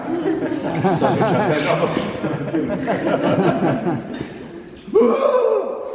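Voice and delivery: grand rire fort et haut; éclate de rire après la chute; éclat de rire. Real, not acted. (éclat de rire de l'orateur)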